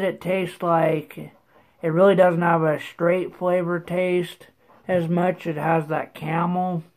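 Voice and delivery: talking around tobacco pouch; slightly thick lip speech. Real, not acted. Seen 0: Lower lip looks slightly bulged throughout; a tin is shown in R1.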